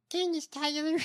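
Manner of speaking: very high-pitched, softened mock voice; imitated politician voice. Shown as a demonstration. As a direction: say the name in her strange high voice. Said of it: "With that voice?".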